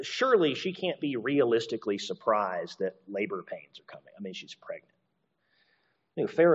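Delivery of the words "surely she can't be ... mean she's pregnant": whispered objection. Pretend. Imagined objector, whispered aside.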